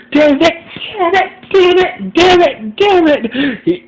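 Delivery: high-pitched, wailing frustrated curse; frustrated shrieking. Acted. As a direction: shriek it in helpless frustration, almost crying. John beating chair in frustration.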